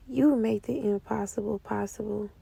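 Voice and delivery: low, unvoiced whisper to the Lord; whispered prayer. A woman whispering her faith back to God. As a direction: hush it like a private vow.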